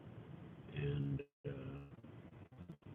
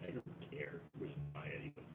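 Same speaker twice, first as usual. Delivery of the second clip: muttered defiance; 5 semitones lower, 12 dB quieter than usual. Retold or imitated. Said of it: "I'm going like".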